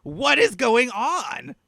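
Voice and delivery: high, slow bewildered voice; confused stranger calling out in the woods; slow confused NPC. Acted. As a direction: drag it out, puzzled. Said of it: "someone just say".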